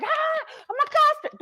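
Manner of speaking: high, slow mock alarm; mock panic. Cook mimics a viewer panicking over custard, hammed-up alarm. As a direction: cry out as if the custard is ruined.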